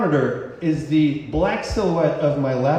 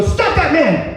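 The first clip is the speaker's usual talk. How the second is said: higher, louder guard's shout; shouted order; 8 semitones higher, 5 dB louder, slower than usual. Retold or imitated. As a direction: bark the command to stop him. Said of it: "I hear somebody go".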